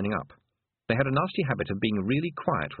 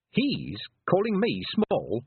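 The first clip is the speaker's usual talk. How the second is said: high, slow, incredulous question; affronted disbelief; 8 semitones higher, slower than usual. Acted. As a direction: draw it out in offended disbelief. Girl affronted by small Scot's insult.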